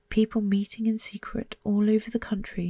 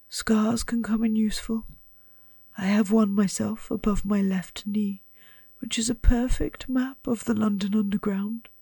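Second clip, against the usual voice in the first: lightly voiced old wizard, whispered; hint of a kindly old headmaster; Dumbledore; 3 semitones higher than usual.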